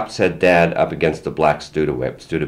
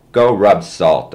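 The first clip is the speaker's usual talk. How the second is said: slow, loud defiant yell, higher; a kid's slow defiant holler; 3 semitones higher, 6 dB louder, slower than usual. A narrator's reading. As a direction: shout it back slowly through the door. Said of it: "I yelled".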